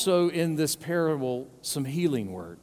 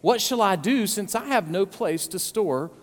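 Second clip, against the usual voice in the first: raised, brisk Bible reading; scripture reading; 4 semitones higher than usual. Acted. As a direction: read the verses with weight. Reads scripture aloud.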